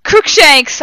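high, loud acted spell shout. A narrator's reading. Heroine shouting a spell at Voldemort, loud high shout. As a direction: fling it out at full volume. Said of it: "I shouted at him".

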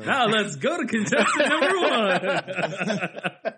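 high, loud, slow announcer. Acted. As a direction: announce it like a TV host. Announcer voice again.

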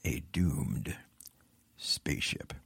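low, dark whisper of doom; horror trailer voice. Shown as a demonstration. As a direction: breathe it like a scary movie trailer. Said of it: "I want to say that again".

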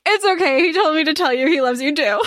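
high voice breaking into laughter; laughing reply. Retold or imitated. Nari reassuring her brother's wife, laughing. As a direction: laugh through the line as Nari.

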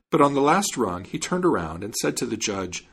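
ordinary delivery, nothing marked

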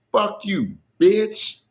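high, hammed-up "Fuck you, bitch" insult; mock the heckler's high cussing-out; imitating a woman's angry retort. Comic retelling heckler's insult, hammed-up high voice. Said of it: "she looked right at me and she said".

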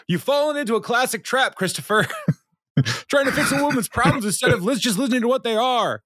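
high-pitched mock Ron yelling through headphones; retold yell. Ron lecturing Chris through headphones, mock high-pitched yell.

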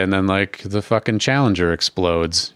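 ordinary delivery

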